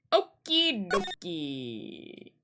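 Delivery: very slow, stretched syllables; drawn-out okay. Real, not acted. Player moves on from the scene, slow and stretched. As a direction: draw out each part of it.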